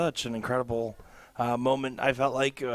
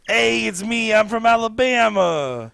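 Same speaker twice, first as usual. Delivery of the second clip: put-on high, loud, slow hammy Alabama accent; 7 semitones higher, 8 dB louder, slower than usual. Commentator showing off his Alabama accent impression, loud and hammy. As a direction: ham up an Alabama drawl, pitched high. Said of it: "I could do a pretty good, like, Alabama one".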